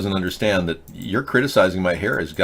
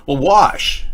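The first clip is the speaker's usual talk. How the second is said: ordinary talk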